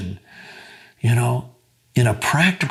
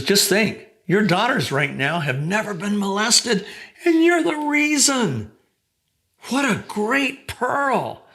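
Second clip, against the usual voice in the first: rising higher and higher with wonder; excited praise; 8 semitones higher than usual. Retold or imitated. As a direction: voice climbing much higher in delighted amazement. Counselor praising her, retold excitedly.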